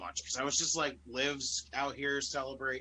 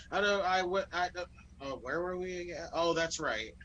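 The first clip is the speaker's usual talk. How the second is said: flustered, higher-pitched stumbling; Ronda; 5 semitones higher than usual. Retold or imitated. Imitating a wrestler losing her place on the mic, flustered and stumbling. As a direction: trip over false starts, then recover. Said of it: "She just talks too fast sometimes".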